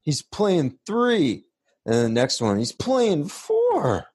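rising, stretched-out disbelief; mock amazement. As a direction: drag out each count in disbelief. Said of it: "it was like".

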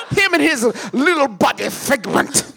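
Tim Curry voice through a laugh; lightly voiced Tim Curry, laughing; Tim Curry impression. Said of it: "an impeccable Tim Curry".

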